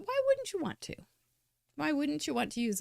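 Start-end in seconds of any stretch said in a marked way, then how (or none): 0.0-1.0 excited squeal. Acted. Excited rhetorical question to viewers.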